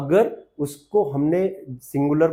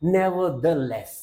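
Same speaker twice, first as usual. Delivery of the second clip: very slow demo; 2 semitones higher, much slower than usual. Shown as a demonstration. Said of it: "फिर भी को क्या बोल देते हैं".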